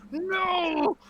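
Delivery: high, loud mock-rage wail; drawn-out, high howl of pretend fury; enraged gamer outburst. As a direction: cry out in hammed-up gamer agony. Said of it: "also enraged inducing".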